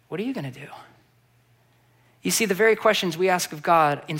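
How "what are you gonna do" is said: hushed, much softer answer; quiet reply. Acted. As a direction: answer softly, turning it back.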